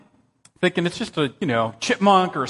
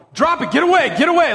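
high, louder shout to get away; yelling at the bear; 9 semitones higher, 4 dB louder than usual. Retold or imitated. Pastor yelling at an approaching bear, high loud shout. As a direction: drive the bear off with your voice. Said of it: "I'm yelling at it".

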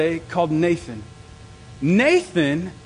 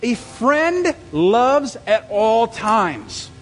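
scripture reading; 4 semitones higher, much slower than usual. Shown as a demonstration. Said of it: "It says".

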